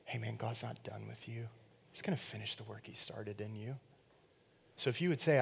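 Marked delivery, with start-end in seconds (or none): 0.1-3.8 tender reassurance. Retold or imitated. Friend lifting your shoulders.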